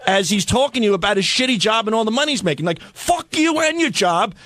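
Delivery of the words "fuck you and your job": high-pitched, drawn-out comic fury; mock angry outburst. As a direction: let loose a hammed-up curse at a boring dinner guest. Acted fantasy outburst at dinner companion.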